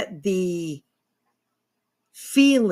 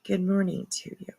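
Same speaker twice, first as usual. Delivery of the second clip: sly soft greeting; 7 dB quieter than usual. Pretend. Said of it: "Wink, wink".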